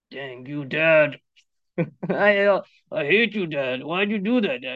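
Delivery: mock sulky child, low and sullen; bratty kid. Preacher mocking a modern child snapping at dad, sullen. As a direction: sulk at dad like a spoiled kid. Mocks how kids talk now.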